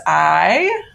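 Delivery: "I" held long with a firm push; "I" isolated and emphatic; stress on I. Shown as a demonstration. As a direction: lean hard on "I," drawing it out.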